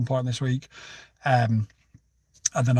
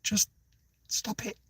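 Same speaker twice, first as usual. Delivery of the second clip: softer, fading into a whispered plea; exasperated whisper; 6 semitones higher, 6 dB quieter, slower than usual. Retold or imitated. Silently pleading with the woman, whispered. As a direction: beg her to stop under your breath. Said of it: "I'm like".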